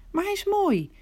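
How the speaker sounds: high, dreamy mock of her sister; mocking Maria. Martha imitating her sister's rapture, high mock dreaminess.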